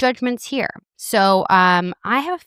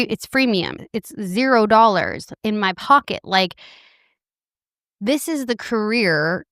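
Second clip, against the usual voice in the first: ordinary talk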